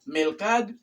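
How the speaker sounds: "mercad" said as "melcad", final o dropped; "mercad" as "melcad", no last vowel; clipped learner's error. Retold or imitated. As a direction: imitate the mistake, "mercad" with L for R. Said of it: "pero no se dice".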